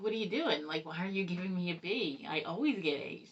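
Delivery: loud, laughing mock outrage from the son; indignant loud quote. Son protesting to his teacher, mock-outraged and loud. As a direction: play the son demanding why he got a B, half laughing.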